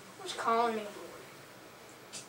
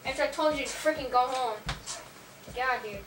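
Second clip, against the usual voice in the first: normal talking voice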